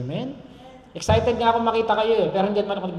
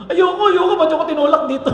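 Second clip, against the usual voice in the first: higher, louder mock wail, laughing through it; hammed-up protest; 9 semitones higher, 9 dB louder than usual. Preacher playing the family pushed into the grave, loud mock wail. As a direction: protest like someone shoved into a grave.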